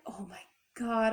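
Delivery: softly whispered, retold disbelief; stunned whisper. Woman looking over at her totaled car, stunned whisper. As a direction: whisper it as if taking in the damage.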